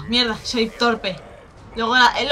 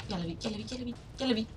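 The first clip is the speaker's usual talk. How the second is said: low hushed murmur; quiet; 2 semitones lower, 13 dB quieter, faster than usual. Real, not acted. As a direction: murmur it under your breath. Spots enemy quietly.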